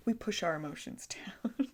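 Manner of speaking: soft whispery mock advice; hushed suppression. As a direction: sink into a whisper, as if pushing it down. Jokes about suppressing feelings.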